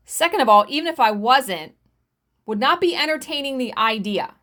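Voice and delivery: ordinary talk, nothing marked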